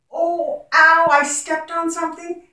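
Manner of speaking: high hammed-up ouch after stepping on something; teacher shows the ow sound with a mock cry. Shown as a demonstration. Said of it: "the ow sound".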